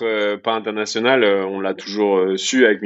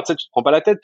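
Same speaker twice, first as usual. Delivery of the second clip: ordinary talk, nothing marked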